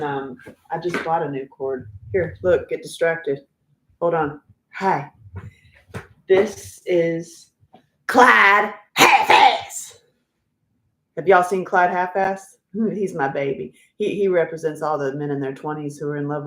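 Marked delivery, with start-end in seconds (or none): 6.3-10.0 loud, drawn-out, higher introduction; grand announcement. Pretend. Introducing Clyde to the viewers, grandly announced. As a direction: announce the name slowly like a star entrance.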